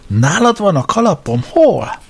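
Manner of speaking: bright, high, hopeful surprise; as if the lost hat were finally found; eager surprise. Acted. Braddock to Sam, about his lost hat: sudden hopeful surprise.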